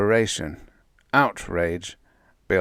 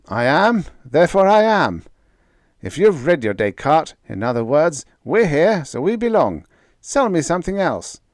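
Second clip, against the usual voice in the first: loud, higher, cheeky bravado; brazen; 8 semitones higher, 7 dB louder than usual. A narrator's reading. Bill brazenly answering the cosmic Voice, loud. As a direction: bluff your way through with nerve. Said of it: "said brazenly".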